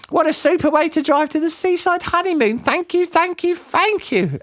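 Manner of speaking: high, breathless gushing thanks; over-the-top grateful aunt; gushing auntie. A narrator's reading. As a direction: Auntie Flip, delighted. Auntie Flip thrilled.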